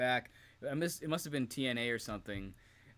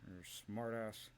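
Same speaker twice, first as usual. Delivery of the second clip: softer, slow gruff put-down; grumpy agent; 9 dB quieter than usual. Retold or imitated. Imitating agent Tony Garea dismissing a wrestler, slow grumble. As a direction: drag the insult out grumpily. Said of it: "He does a Tony Garea".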